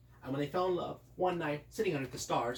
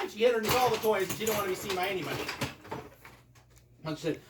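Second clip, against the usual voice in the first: plain reading voice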